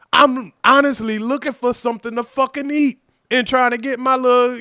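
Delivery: normal talking voice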